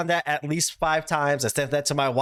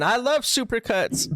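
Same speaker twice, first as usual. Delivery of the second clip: mock yell; 7 semitones higher than usual. Retelling the wrestler's outburst during the objection, mild mock yell. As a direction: imitate a wrestler's scream at conversational volume. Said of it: "Marcus also as well screaming".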